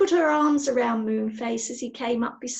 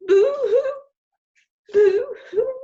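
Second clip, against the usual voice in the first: high, loud mock sobbing boo-hoo; acted boo-hoo sob; 13 semitones higher, 9 dB louder, slower than usual. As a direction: wail boo-hoo like a crying child. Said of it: "Connie sobbed".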